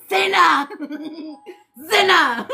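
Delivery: loud playful call of Zena; cheerful shout of the name. Pretend. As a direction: call out to Zena across the room. Calling out a name.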